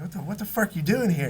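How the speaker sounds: can't believe he's here; surprised disbelief. Retold or imitated. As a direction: friend demanding why his buddy is on the couch, amazed. Spots old friend unexpectedly.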